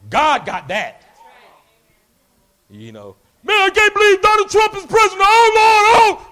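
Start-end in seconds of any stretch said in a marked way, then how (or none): 3.4-6.3 higher, louder lament; mock complainer. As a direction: whine to the Lord about it. Voicing someone upset about politics.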